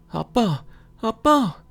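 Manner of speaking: high, loud panicked shout for dad; frantic calling. Acted. Son frantically calling his father, loud high shout. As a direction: scream for him in alarm.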